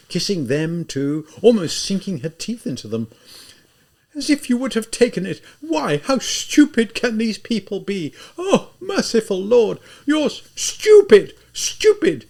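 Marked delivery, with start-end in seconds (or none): 4.1-12.2 high, louder furious shout. Acted. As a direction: rail at the whole room. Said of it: "she shouted".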